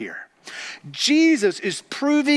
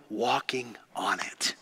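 soft whisper, much lower; 11 semitones lower, 11 dB quieter than usual. Real, not acted. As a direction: let it fall to a whisper in awe. Intimate aside in sermon.

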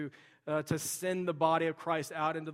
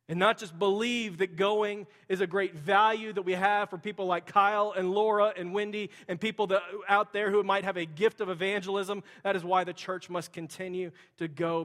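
louder, higher pulpit exhortation; preaching at full force; 5 semitones higher, 10 dB louder than usual. Acted. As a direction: urge the church on with fervor.

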